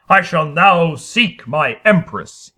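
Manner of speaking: slow, loud regal proclamation; grand declaration. Acted. Harrison proclaiming to the cowering people, slow and regal. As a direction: announce it like a king.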